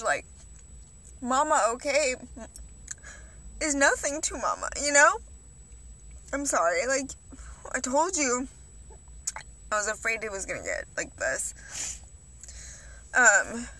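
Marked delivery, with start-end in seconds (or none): 1.2-12.0 lightly imitating mom's broken English, a bit higher; a hint of mom's accented English, gentle and soothing. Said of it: "in her broken English".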